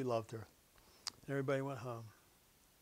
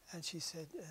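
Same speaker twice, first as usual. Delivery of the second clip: ordinary talk, nothing marked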